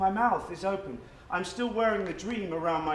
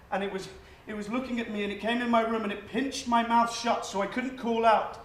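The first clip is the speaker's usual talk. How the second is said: panicked; 6 semitones higher than usual. Acted. Blurting out an invented nightmare.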